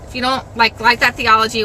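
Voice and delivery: ordinary delivery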